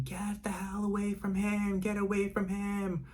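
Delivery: high-pitched imitated yell, held back in volume; thin high mock scream; retold scream. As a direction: act out a rescuer screaming without really shouting.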